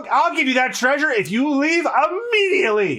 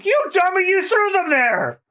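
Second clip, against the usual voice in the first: scolding shout; 7 semitones higher than usual. Acted. Scolding a partner.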